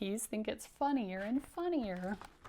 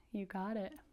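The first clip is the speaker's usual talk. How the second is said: usual speaking voice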